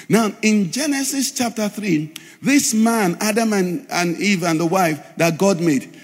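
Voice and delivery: ordinary talk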